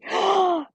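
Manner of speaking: loud, high gasp; delighted gasp. Real, not acted. Streamer hears that chat approved, delighted gasp. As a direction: gasp in happy surprise.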